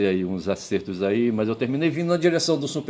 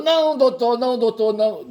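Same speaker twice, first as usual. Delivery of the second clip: desperate woman's cry; 12 semitones higher than usual. Retold or imitated. Wrong take: he slips and calls the king "doutor", panicked cry. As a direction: beg in panic, voice up high. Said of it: "uma das mulheres gritou".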